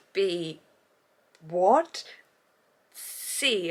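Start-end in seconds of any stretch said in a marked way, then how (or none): 1.5-2.0 baffled what. Real, not acted. Listing reasons the twist baffled her.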